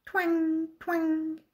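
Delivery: web twang. A narrator's reading. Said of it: "the spider twanged her web".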